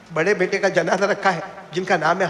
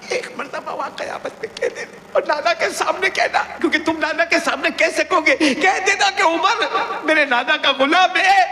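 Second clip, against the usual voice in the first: high, pleading voice for Umar; 12 semitones higher than usual. Acted. Umar begging Hussain to speak for him, high and pleading. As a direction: plead up high, with a laugh breaking in at the end.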